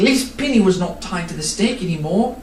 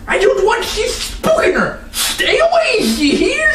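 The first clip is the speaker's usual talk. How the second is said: high, fast rough rant; ranting villain; 12 semitones higher than usual. Acted. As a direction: rail at her to stay away. Nye rants about his horse.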